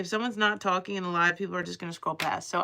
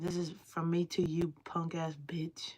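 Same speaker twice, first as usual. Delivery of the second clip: mock whispered insult; 2 semitones lower, 6 dB quieter than usual. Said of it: "I said".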